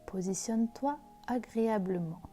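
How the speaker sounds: slow, soft meditation voice; guided meditation. Acted. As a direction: guide them into calm, gently. Leads a guided visualisation.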